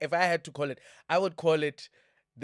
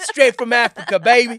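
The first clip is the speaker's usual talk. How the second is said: loud, high hammed-up American accent; 8 semitones higher, 17 dB louder than usual. Host hailing viewers in Russia, playful American accent. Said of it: "I hit you with an American accent".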